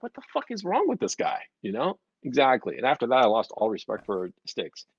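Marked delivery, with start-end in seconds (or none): 0.0-1.4 high-pitched incredulous question; mock disbelief. Onlooker reacting to a lost adult's panic, incredulous. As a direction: play a baffled onlooker, pitched up.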